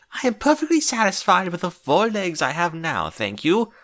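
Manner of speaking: Rarity snaps back in a high, prim pony voice; snappy retort. Acted. Rarity snapping back at Twilight, high and offended. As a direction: fire it right back at her, offended. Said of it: "she shot back".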